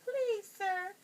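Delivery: high, squeaky little mouse voice; tiny frightened mouse squeaking. A narrator's reading. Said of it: "cried the little mouse".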